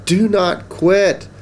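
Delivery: high, drawn-out urging; urging encouragement. Acted. Pup and Pig push Duck to keep trying, encouraging.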